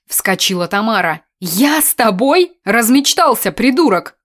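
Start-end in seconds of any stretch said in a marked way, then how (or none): 1.4-4.2 higher, louder scornful rejection; incredulous and contemptuous, pitched up; scornful outrage. A narrator's reading. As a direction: throw it back as an indignant insult. Tamara scornfully rejects him.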